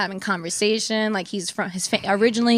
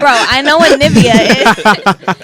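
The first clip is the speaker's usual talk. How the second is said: loud, incredulous retort; retold protest at full volume; exasperated outburst; 2 semitones higher, 11 dB louder than usual. Friend reacts to his ordinary lotion, exasperated outburst.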